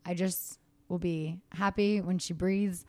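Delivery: normal talking voice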